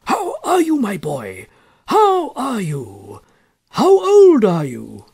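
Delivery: put-on high, eager old gentleman, drawn out slowly; high-pitched elderly man gushing a welcome; delighted old cousin. A narrator's reading. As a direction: greet the orphan boy with fussy delight. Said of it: "rubbing his hands with delight".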